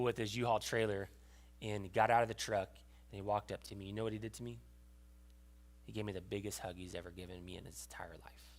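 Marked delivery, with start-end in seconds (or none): 5.8-8.5 softly, almost unvoiced, overcome; hushed whisper. Real, not acted. Preacher telling congregation of dad's hug, whispered. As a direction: whisper it, moved. Emotional climax of story.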